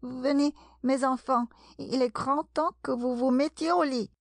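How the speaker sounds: high, trembling mother's call; voix tremblante. A narrator's reading. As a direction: call your little ones anxiously, high-pitched. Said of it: "cria à ses petits d'une voix tremblante". (appel aigu d'une voix tremblante)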